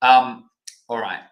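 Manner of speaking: normal talking voice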